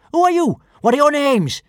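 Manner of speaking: put-on high, loud little-girl challenge; fierce demand. A narrator's reading. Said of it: "she demanded fiercely".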